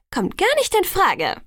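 high, smug refusal; gloating. A narrator's reading. Alice overrules Edward's plan, gloating. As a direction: rub it in with relish. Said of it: "sagte Alice hämisch".

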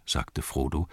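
plain reading voice

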